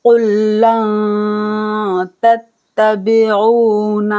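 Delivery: slow chant stretching the long vowels; Quran recitation. Shown as a demonstration. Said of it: "कुल मोटा पढ़ेंगे".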